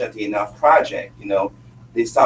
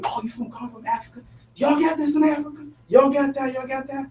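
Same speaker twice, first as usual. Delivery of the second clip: imitating the questioner; 13 semitones higher, 4 dB quieter than usual. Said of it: "He starts to ask me".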